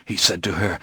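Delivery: plain reading voice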